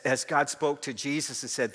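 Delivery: usual speaking voice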